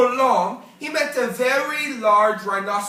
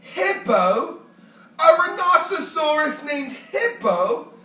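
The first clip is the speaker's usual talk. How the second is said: rising, incredulous question; can't quite believe the name; Fluffy the porcupine, amused and doubtful; amused disbelief; 6 semitones higher, 4 dB louder than usual. A narrator's reading. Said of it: "Fluffy smiled".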